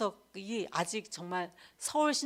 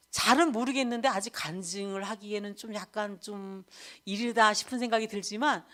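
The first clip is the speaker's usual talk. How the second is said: usual speaking voice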